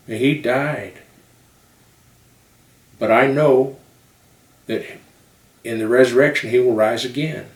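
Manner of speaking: put-on Southern-accented sermon storytelling with punched phrases; Southern preacher. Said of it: "but they talk like this".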